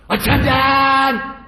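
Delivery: loud, high, drawn-out furious yell; angry shout. Acted. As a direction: bellow across the temple in fury. Angry master calling the attendant.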